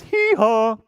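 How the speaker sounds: high, loud braying hee haw; donkey bray. Acted. Storyteller brays as the donkey. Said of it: "Donkey says".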